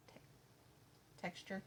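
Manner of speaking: numb mouth, slurred words; numb from the dentist. Real, not acted. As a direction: talk through a numb mouth. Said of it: "My mouth don't work right".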